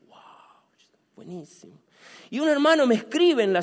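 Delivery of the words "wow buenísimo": hushed whispered approval; soft, toneless whispered delight. Retold or imitated. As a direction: whisper it under your breath. His whispered reaction to sister.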